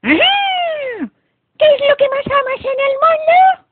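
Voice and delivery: very high, loud villain's scream; piercing, menacing witchy yell; evil fairy shriek. A narrator's reading. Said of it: "Gritó el hada mala".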